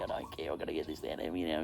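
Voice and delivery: low, hushed, hurried inner voice; desperate muttering. Retold or imitated. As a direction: mutter it under your breath, urgently. Retold desperate thought, muttered to himself.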